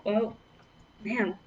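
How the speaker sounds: ordinary delivery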